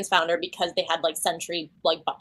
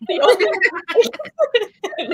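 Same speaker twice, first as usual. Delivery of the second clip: words stretched out in higher laughter; dissolving into laughter; 4 dB louder, much slower than usual. Real, not acted. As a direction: laugh too hard to go on. Laughing at her own joke.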